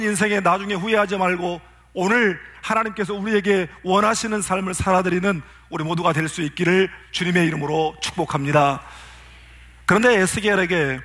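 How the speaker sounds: usual speaking voice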